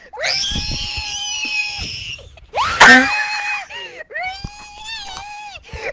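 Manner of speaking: long high screeching wheeze; screech laugh. Real, not acted. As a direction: let out a squealing screech. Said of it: "I can't wheeze right now".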